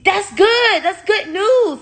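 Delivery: high, mocking voice for the doctor; mock her bright, cheery tone; doctor's chirpy reassurance, mocked high. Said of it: "she was like".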